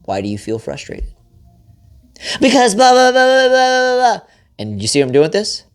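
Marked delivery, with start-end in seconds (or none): 0.0-1.1 soft calm question. Shown as a demonstration. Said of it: "husband models gentle question". 2.4-4.2 high, loud blah-blah wife voice; mock wife venting. Husband parodies his wife's endless venting, high and loud. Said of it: "she's gonna be like".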